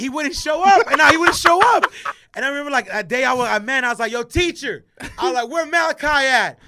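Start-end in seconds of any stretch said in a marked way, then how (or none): 0.0-1.9 high, loud incredulous complaint; exasperated outburst. Real, not acted. Exasperated retelling, voice raised. 4.1-6.6 put-on higher, louder kid calling the teacher; mock calling out.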